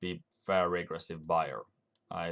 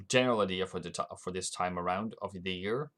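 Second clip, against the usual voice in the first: ordinary talk, nothing marked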